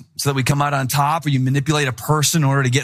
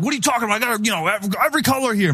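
much higher, indignant retort; mock offended protest; irritated seller; 8 semitones higher, much faster than usual. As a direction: seller snapping back at the picky buyer, indignant. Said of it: "he started getting angry".

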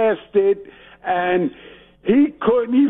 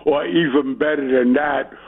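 ordinary talk

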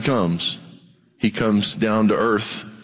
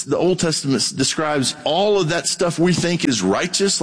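ordinary talk

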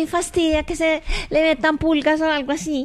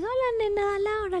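soft, raised, sweet hello; tender greeting; 5 semitones higher, 7 dB quieter than usual. Retold or imitated. Child imitates how the host greets her, tender. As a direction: imitate someone greeting a little girl tenderly. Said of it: "cuando tú me dices".

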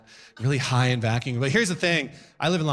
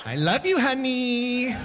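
sarcastic sweetness; 10 semitones higher, slower than usual. Acted. Sarcastic loving sign-off.

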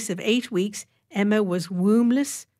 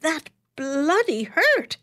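much higher, slow outraged complaint; pained exclamation; 10 semitones higher, 3 dB louder, slower than usual. Acted. The waxed doctor complains to Emma, high slow pained outburst. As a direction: drag it out in pain. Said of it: "he exclaimed".

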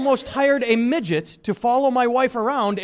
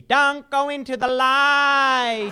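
slow, drawn-out eerie call; hammed-up spooky medium warning the baby; long eerie Poltergeist-style plea; Poltergeist psychic call; 5 semitones higher, much slower than usual. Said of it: "have her yell at my baby".

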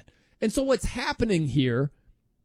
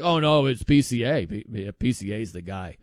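lower, deadpan mock of the doubters; straight-faced mock; 8 semitones lower than usual. Mocking people who pick PCA over Shohei, lower deadpan. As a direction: say it straight-faced, as if it were obvious. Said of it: "saying it with a straight face".

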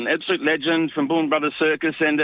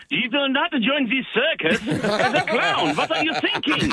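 laughing mock of strict German parents, higher and slow; mock stern parents; 8 semitones higher, slower than usual. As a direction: play the outraged parent while laughing. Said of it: "And they're going".

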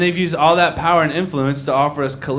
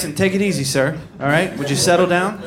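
ordinary delivery

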